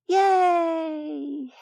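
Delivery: high, drawn-out mock cheer; a fake little cheer; sarcastic yay. A narrator's reading.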